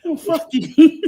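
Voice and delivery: high, loud cursing through laughter; squealing laugh at the teasing; laughing curse. Real, not acted. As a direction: laugh it off while cramped up. Laughing back at friend's teasing.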